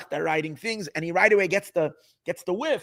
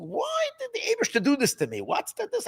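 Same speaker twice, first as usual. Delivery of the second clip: exasperated high complaint; 3 dB quieter than usual. Retold or imitated. Rabbi complains to himself in thought.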